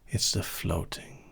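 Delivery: ordinary talk, nothing marked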